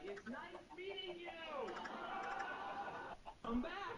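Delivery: high, slow and sweet polite lady; high chirpy brush-off. Retold or imitated. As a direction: lightly voice a sugary farewell. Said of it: "she was like".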